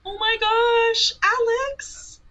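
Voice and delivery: high, slow delighted cry; excited. Real, not acted. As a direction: burst out with joy at the name. Thanking a subscriber by name.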